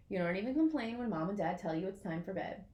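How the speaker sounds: plain reading voice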